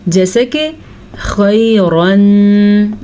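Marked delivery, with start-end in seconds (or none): 1.2-2.9 खैरन drawn out, ra thick; heavy ra. Shown as a demonstration. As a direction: stretch खैरन, ra full. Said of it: "हमने रॉ को क्यों पुर पढ़ा". (खैरन में रॉ पुर)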